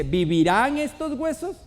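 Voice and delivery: lightly dramatised voice from heaven; thunderous divine voice. Acted. God challenging Ezekiel among dry bones, dramatic rising question. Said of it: "Y la voz de trueno".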